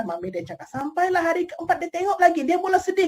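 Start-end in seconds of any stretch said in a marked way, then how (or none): none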